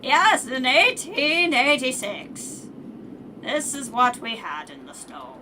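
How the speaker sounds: put-on loud, slow old person voice. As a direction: drag it out like an old man, loudly. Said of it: "Old person voices it like".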